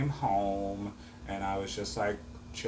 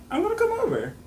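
high insistent repeat; 5 dB louder, faster than usual. Retold or imitated. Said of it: "And I was like".

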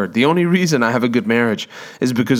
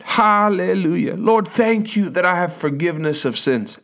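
rejoicing from the spirit; 5 semitones higher than usual. Retold or imitated. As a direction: pour out heartfelt thanks. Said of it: "from your heart, just from your spirit".

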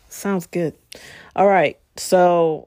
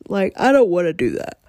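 mid-yawn, words stretched. Real, not acted. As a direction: talk through a yawn. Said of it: "I'm yawning".

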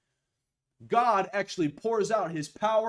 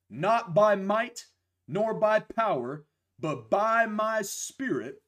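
slow, rising scripture proclamation; slow emphatic scripture reading; 4 semitones higher, slower than usual. Acted. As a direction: proclaim each phrase slowly.

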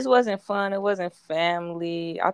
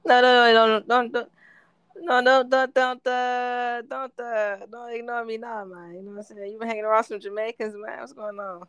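higher-pitched mock Jamaican accent; bad Jamaican accent; 5 semitones higher than usual. Teasing co-host about hanging around Jamaicans, bad Jamaican accent. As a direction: put on a clumsy Jamaican accent. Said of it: "Worst Jamaican accent".

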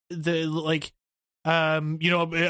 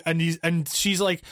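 ordinary delivery

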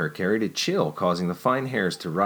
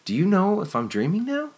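much higher, playful and teasing; impish question; 12 semitones higher, 4 dB louder than usual. Acted. Jennifer teasing Nicholas, much higher and impish. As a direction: ask it like a mischievous child. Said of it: "her impish stare".

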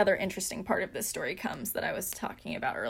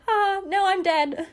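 much higher and louder, cracking up mid-quote; laughing joke; 11 semitones higher, 6 dB louder, slower than usual. Retold or imitated. Retelling her text to the audience, laughing hard. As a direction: squeal it through laughter at her own dark joke. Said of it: "I just said".

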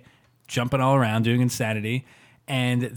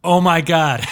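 loud, higher-pitched mock shock; all-caps outburst; 4 semitones higher, 8 dB louder, slower than usual. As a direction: read out a shouted text message. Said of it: "he wrote in all caps".

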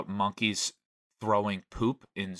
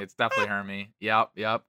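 normal talking voice

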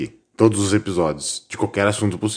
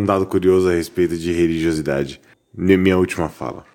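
ordinary talk, nothing marked

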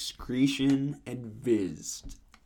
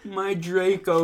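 moaned; 6 semitones higher, 3 dB louder, slower than usual. A narrator's reading. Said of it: "he moaned".

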